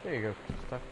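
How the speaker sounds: ordinary talk, nothing marked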